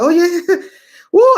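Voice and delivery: high, loud laughing cheer; jubilant whoop. Retold or imitated. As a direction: whoop it out at the finish line. Crossing finish line joy.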